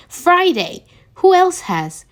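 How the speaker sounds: surprised. A narrator's reading. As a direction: jump on it in disbelief.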